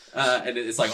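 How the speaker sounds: ordinary talk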